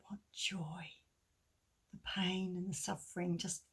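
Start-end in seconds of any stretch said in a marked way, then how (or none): 0.1-0.9 hushed joy. Real, not acted. Birth of a baby.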